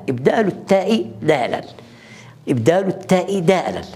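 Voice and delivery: ordinary talk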